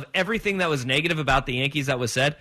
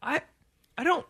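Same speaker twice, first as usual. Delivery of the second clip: higher and fast, exasperated; 9 semitones higher, faster than usual. Retold or imitated. Fan exasperated at Yankee fans, higher exasperated. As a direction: throw up your hands in exasperation. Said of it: "I was watching the game going".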